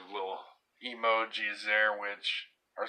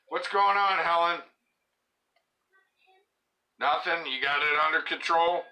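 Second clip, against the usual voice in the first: raised, higher voice calling to the next room; 10 semitones higher, 6 dB louder than usual. Real, not acted. Calling to Helen in the other room about tablet trouble, raised call. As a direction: call out across the house to check on her.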